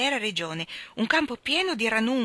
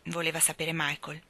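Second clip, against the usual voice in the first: usual speaking voice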